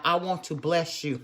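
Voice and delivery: ordinary talk, nothing marked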